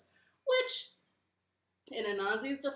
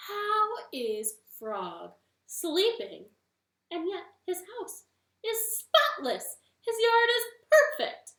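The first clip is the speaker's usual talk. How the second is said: rising higher and louder in amazement; amazed spider; 10 semitones higher, 10 dB louder than usual. Acted. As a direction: marvel more with each line. Anansi marvels at Frog's house.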